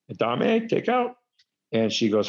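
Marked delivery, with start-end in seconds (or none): 0.1-1.1 mock confused question. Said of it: "I was like".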